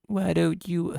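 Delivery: put-on high squeaky Victorian child; shrill stagey period child. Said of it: "Basically Victorian child voice".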